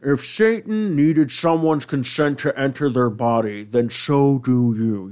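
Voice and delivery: slow, drawling put-on devil voice; South Park Satan. Reading Satan's tweet aloud, slow cartoon devil. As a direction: stretch each word like a cartoon devil. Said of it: "the South Park Satan".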